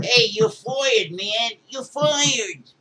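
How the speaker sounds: high, drawn-out put-on voice; mock firing. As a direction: taunt loudly in a silly high voice. Acted firing of Trump, mocking voice.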